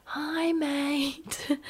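lightly mocked slow, high "Hi, mate"; slow gentle greeting. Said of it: "talking to him so slowly".

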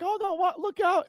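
high, startled cry of warning; mock alarm. Warning the kid near the chocolate river, high alarmed cry. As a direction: shriek like a kid is about to fall. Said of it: "they're like".

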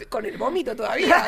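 usual speaking voice

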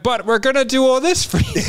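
high, loud pushy salesman voice; mock eager salesman. Retelling a sales call, mimicking the rep's pushy pitch. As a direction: ham up the overeager sales rep.

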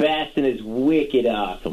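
put-on slow laughing Boston accent. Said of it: "in your best Boston accent".

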